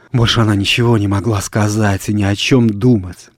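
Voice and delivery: ordinary delivery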